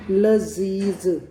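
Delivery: लजीज drawn out very slowly with two buzzing z sounds; लज़ीज़ में दोनों ज़, बहुत धीरे; both z, very slow. Shown as a demonstration. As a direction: stretch लजीज and make both z sounds buzz. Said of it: "दोनों में संघर्षी है".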